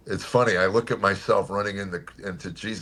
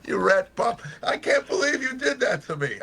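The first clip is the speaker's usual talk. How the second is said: much higher, hammed-up indignant complaint; mock outrage; 4 dB louder than usual. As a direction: play-act being betrayed by an old friend. Joking outrage at his friend.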